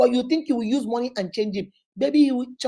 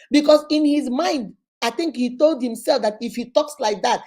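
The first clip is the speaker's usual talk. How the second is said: ordinary delivery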